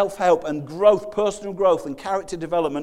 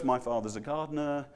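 mock polite shrug; 6 semitones lower, 5 dB quieter than usual. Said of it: "it feels kind of quite innocuous".

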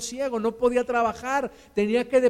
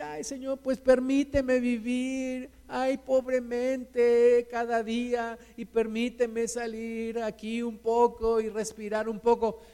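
plaintive pleading. Acted. Believer asking God only to survive, plaintive pleading. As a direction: drag it out like a meek, sorry plea. Acts out a meek, resigned prayer.

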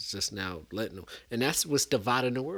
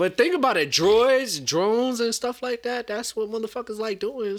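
higher and louder, fired up; excited; 10 semitones higher, 7 dB louder than usual. Real, not acted. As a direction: talk it up, over the others. Talking over friends, excited.

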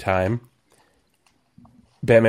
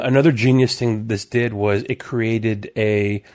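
ordinary talk, nothing marked

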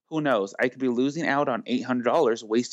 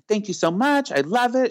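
gushing thanks; 10 semitones higher, 4 dB louder than usual. Retold or imitated. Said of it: "she was like".

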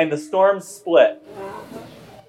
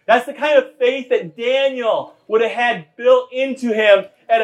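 full-force preaching; 8 semitones higher than usual. Acted. Pastor urging the congregation to build faith in children, full-force preaching. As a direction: preach it out to the whole church. Preacher driving home the sermon point.

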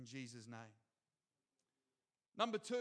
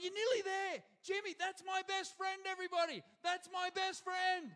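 much higher, louder bragging yell about a best friend; proud cheering; 12 semitones higher, 5 dB louder than usual. Retold or imitated. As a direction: shout it out proudly to the crowd. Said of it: "And he's going".

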